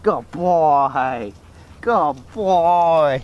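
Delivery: reward the dog gently; praising a dog. Real, not acted. Praising dog after recall.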